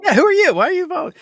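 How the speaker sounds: high squeaky voice breaking into laughter; laughing mock quote. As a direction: play the puzzled stranger while cracking up. Mocking the message to a follower.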